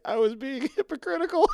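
high, breaking into laughter; words overtaken by real laughter; laughing. Real, not acted. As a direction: crack up while quoting the line. Cracking up while retelling a line.

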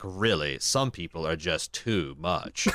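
soft, low, slow prissy robot. Retold or imitated. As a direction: sniff at people like a prim machine. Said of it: "my prissy robot impression".

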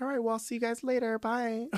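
high, fast, cheerful farewell; breezy sweet goodbye. Retold or imitated. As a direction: flip to sugary nice guy. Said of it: "I was like".

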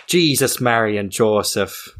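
hammed-up Irish accent, drawn out; mock Irish exclamation. Joking with co-host about an Irish accent, mock Irish exclamation. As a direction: throw up your hands like a stage Irishman.

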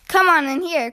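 story-reader's restrained call to a playmate. A narrator's reading. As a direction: lightly call a friend over. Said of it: "called Lizzie from the garage".

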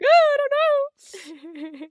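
high, slow, crying wail; tearful quote. Retold or imitated. To her fiancé, crying high and slow. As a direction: choke it out through tears. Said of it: "I'm like".

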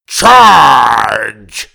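loud battle cry; yelling. Acted. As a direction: bellow the charge order. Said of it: "he yelled".

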